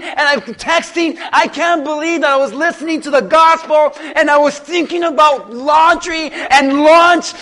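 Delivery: anguished shout of regret. Acted. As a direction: cry out like a soul in hell looking back. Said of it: "you're gonna go".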